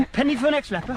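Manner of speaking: put-on British accent. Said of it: "such a British accent".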